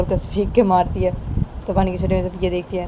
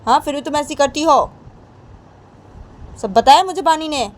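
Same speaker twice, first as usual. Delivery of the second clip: higher, louder voice than the narration; scolding mother-in-law; 8 semitones higher, 5 dB louder than usual. Acted. Mother-in-law questioning bahu over Bani, in pressing reproach. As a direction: confront her with pressing questions.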